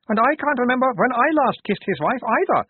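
high, loud character voice; second guard chiming in. A narrator's reading. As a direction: pipe up high with a comic echo. Other guard chimes in, comic echo.

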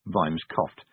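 plain reading voice

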